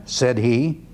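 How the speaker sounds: normal talking voice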